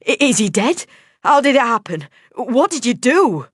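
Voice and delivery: high, loud panicked questions; stammering panic over the dead man. A narrator's reading. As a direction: fire questions in rising panic. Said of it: "I shouted in panic".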